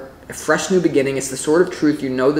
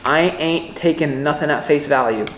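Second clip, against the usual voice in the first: normal talking voice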